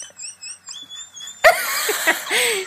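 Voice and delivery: high squeal of laughter. Real, not acted. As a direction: laugh like a leaking balloon. Laughing at balloon sound.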